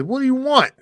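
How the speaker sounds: high, fed-up bartender; exasperated bartender. Acted. Joke-teller voicing the fed-up bartender, pitched high. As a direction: snap it out, sick of the duck. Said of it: "Bartender says".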